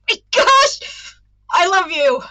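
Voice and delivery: blurted confession. Acted. Starflight confessing love to Sunny, loud high outburst. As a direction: blurt it out before you can stop. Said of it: "he blurted".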